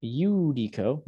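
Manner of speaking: slow YOO-dee-co for Judico; careful Latin Judico, starting "you"; Judico with Y sound. Shown as a demonstration. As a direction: open Judico like a Y. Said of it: "makes a Y sound".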